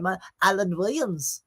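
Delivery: put-on nasal English accent; Alan Williams. Showing viewers how the manager sounds, nasal impression. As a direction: say the name way up in the nose, English. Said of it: "that's exactly what he sounds like".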